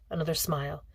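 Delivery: usual speaking voice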